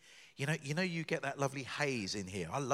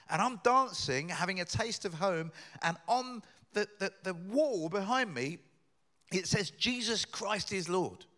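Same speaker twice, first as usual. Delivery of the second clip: excited retelling; 7 semitones higher, 6 dB louder than usual. Retold or imitated. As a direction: carry his amazement up to the line on the wall. Kosovan man's story, rising.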